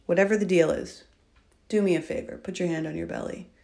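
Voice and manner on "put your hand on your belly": soft, hushed breathing guidance; soft spa whisper. Acted. Leads a breathing exercise.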